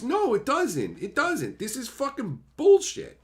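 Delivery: high, indignant contradiction; frustrated arguing back; exasperated rebuttal. Acted. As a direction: shoot it down in high-pitched outrage. Acted argument with the defenders.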